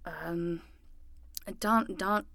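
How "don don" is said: mock detective narration. Narrating detective story mockingly.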